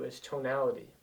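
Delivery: ordinary delivery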